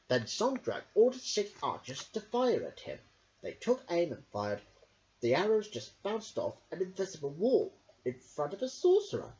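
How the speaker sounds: usual speaking voice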